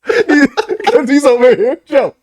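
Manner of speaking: high, slow mock calling out to a lost old man. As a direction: coax him back like a lost grandpa, laughing. Calling Biden back on stage.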